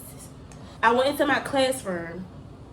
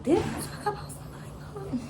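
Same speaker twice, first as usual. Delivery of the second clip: puzzled; 9 semitones higher, 5 dB quieter than usual. Retold or imitated. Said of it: "And I'm like".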